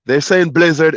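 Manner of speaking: usual speaking voice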